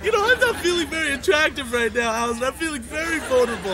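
hurt, on the edge of crying; tearful. Acted. Man feeling vulnerable to his partner, tearfully. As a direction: plead through tears. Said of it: "And don't cry".

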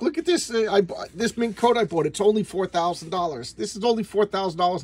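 high-pitched excited wife bragging; gold-digger wife. Retold or imitated. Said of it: "She's like".

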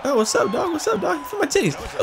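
high, squeaky mock trash talk; playful taunting. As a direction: tease the opponent in a silly high voice. Mouthing off back at a taunt.

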